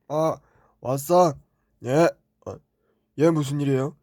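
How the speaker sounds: ordinary talk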